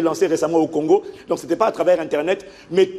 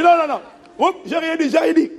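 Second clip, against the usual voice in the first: mock panic; 10 semitones higher than usual. Pastor pretends retreat, joking.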